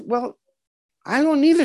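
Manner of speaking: mock high-voiced retort; unbothered mother. Mother admits she doesn't know her size, high retort. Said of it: "She goes".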